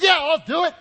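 high, loud exasperated yell; grudging shout. Retold or imitated. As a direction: yell agreement as if it's an imposition. Grudging shouted answer to wife.

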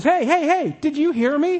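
high, insistent protest; indignant frog. Acted. Frog pocketed by the old man, high indignant protest. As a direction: pipe up urgently as an ignored frog. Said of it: "The frog says".

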